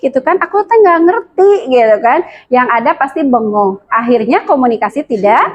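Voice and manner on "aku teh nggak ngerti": mock confused. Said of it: "Dia tuh bingung".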